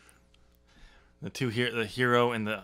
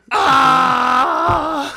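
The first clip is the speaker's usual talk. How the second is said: loud, high, raw scream; a big, drawn-out yell; full scream; 13 semitones higher, 10 dB louder than usual. Pretend. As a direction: let it rip at the top of your voice.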